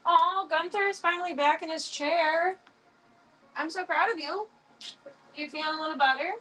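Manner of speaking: high, cooing praise; pet-praising voice. Real, not acted. Cooing to Gunther, back in his chair, high and tender.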